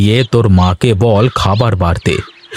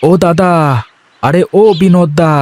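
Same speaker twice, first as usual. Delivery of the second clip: put-on higher, lively young villager voice; a cheerful farmhand talking to himself under the sun; lightly raised village lad; young farmer Ganesh; 7 semitones higher than usual.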